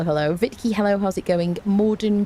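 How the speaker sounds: normal talking voice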